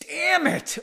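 high, loud exasperated curse; frustrated outburst, voice jumping up; flustered. Real, not acted. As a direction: curse in real frustration at your own fumbling. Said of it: "I feel flustered".

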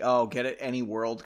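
normal talking voice

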